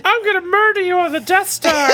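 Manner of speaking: high laughing mock menace; silly high threat voice.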